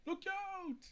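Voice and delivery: high, loud warning; warning shout. Acted. Warning friends as the cave falls, high shout. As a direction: yell it out as rocks fall.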